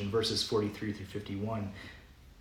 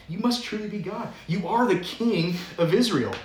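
awed; 6 semitones higher, 4 dB louder than usual. Retold or imitated. Nathanael in awe confessing Jesus.

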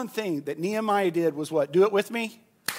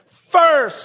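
much louder, higher; said together; 7 semitones higher, 9 dB louder than usual. Shown as a demonstration. Preacher leading the congregation to shout the answer, loud. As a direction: call it out with the crowd. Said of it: "Let's say that together".

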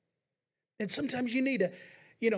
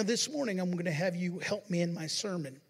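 usual speaking voice

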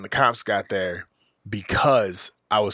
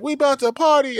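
much higher, louder hyped-up shout; excited friend; 5 dB louder than usual. Retold or imitated. Friend hyped that he's about to be a dad. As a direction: burst out thrilled for him. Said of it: "he's celebrating and whatnot".

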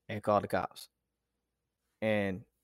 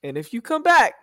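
defiant threat; 2 semitones higher, 4 dB louder than usual. Retold or imitated. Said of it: "this is in my mind".

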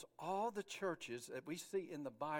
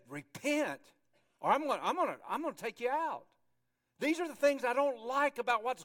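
loud, higher, urgent rebuke; stern rebuke; 6 semitones higher, 8 dB louder than usual. Acted. Jesus confronting wayward churches, stern rebuke. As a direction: lay down the warning forcefully. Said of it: "he said".

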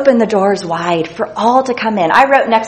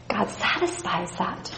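tender hush; 13 dB quieter than usual. Real, not acted. Emotional aside after tears.

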